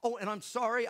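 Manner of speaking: ordinary talk, nothing marked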